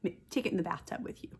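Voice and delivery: normal talking voice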